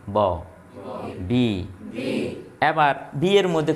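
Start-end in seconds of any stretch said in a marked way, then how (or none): 0.1-0.4 ব popped from closed lips, lower pitch; released from both lips. Shown as a demonstration. Teacher models the letter for pupils, lip-released ব. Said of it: "দুইটা ঠোঁট থেকে ছাইড়া দিবা".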